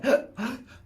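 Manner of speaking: a sudden high, sharp gasp for air. Pretend. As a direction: gasp as if the breath is caught. Losing my breath so I can't breathe.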